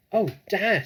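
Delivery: high, loud thrilled cry; excited cry. A narrator's reading. Small fox cries out excitedly to his dad. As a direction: cry out with delight. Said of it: "he cried out".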